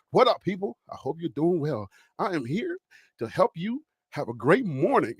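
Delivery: low, smooth radio DJ voice. Shown as a demonstration. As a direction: greet listeners in a deep radio-host voice. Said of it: "You see that radio voice?".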